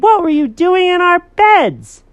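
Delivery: high, loud indignant demand; hammed-up high-pitched telling-off; scolding girl. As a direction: scold the pets as an outraged little girl.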